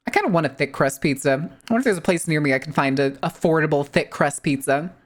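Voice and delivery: usual speaking voice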